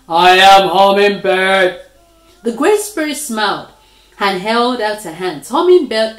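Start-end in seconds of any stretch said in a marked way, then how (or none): 0.1-1.8 announced. A narrator's reading.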